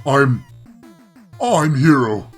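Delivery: forced declaration. A narrator's reading. Player giving in to the game, loud halting declaration. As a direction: force the words out, restarting.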